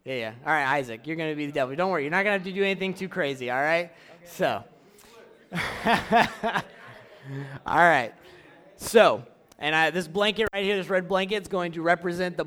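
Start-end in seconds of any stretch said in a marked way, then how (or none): 5.5-6.6 short, loud, higher-pitched chuckle; a burst of amused laughter; laughing. Real, not acted. Chuckling after picking a volunteer to play the devil, laughing.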